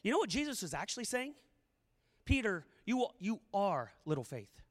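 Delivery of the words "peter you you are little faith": low, quiet naming of Peter; name-calling rebuke. Acted. Jesus to sinking Peter, calling him a name, low rebuke. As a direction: drop low, as if giving him a nickname. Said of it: "He called him a name".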